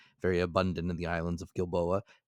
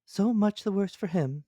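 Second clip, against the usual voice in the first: high, loud little jab of a reply; a servant's quick quip, pitched up; lightly voiced servant Counsel, pitched high; 13 semitones higher, 5 dB louder than usual. A narrator's reading. Counsel retorts to Ned, high and loud.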